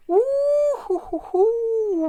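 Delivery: long, high, loud wrestling-style woo; high whooping woo. Real, not acted. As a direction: let out a stretched high cheer. Celebrates on screen.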